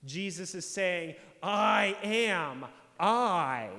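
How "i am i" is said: louder, each word hammered: "I am, I"; emphatic declaration. Retold or imitated. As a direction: declare "I am, I" with full weight on both words. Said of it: "with the same emphasis".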